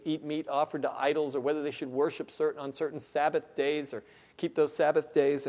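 usual speaking voice

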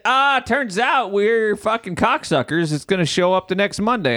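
lightly voiced smug company; hammed-up high taunt from the website; mock website voice. Voicing the delivery website.

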